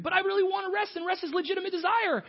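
high whiny protest. Acted. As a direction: plead back in panic. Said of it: "arguing back to God".

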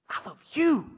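high, piping imitation of his young son; little boy voice. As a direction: play the four-year-old sweetly saying it. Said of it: "Levi talks like that normally".

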